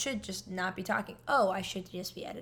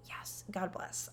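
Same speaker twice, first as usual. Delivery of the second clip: relieved whisper; 7 dB quieter, slower than usual. Retold or imitated. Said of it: "I was like".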